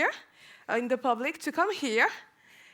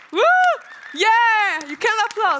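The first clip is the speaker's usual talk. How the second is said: excited hype; 7 semitones higher, 8 dB louder than usual. Real, not acted. Hyping up the crowd.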